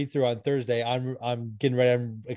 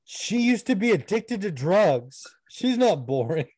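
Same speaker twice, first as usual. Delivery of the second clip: high, slow, put-on protest; indignant sketch character; 7 semitones higher, 3 dB louder, slower than usual. Sketch diner defending his date, high slow mock indignation.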